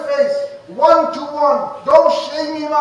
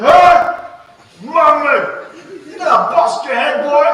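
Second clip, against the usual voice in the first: loud, higher, rough scolding; mock harsh yell; 3 semitones higher, 7 dB louder than usual. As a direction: yell at the kid like a harsh teacher. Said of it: "looked at him and said".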